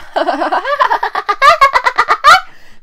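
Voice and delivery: shrieking high laugh; cracking up at the fanfic; high squealing laugh. Real, not acted. Cracking up at the text.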